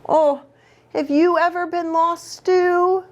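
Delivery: plain reading voice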